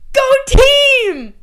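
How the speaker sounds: loud, high-pitched team cheer; mock-enthusiastic shouted cheer; rallying cheer. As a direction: holler it like a hyped-up fan. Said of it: "I would wanna be like".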